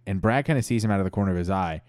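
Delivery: ordinary delivery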